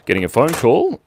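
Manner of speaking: ordinary delivery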